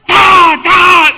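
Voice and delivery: shouted high "Ta, ta" like boards slapping; loud clap sound. Shown as a demonstration. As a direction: bang out the clap like two planks. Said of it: "parece que traen dos tablas en las manos". (imitación gritada de aplauso con tablas)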